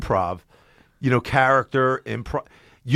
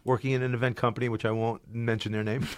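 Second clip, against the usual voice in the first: ordinary delivery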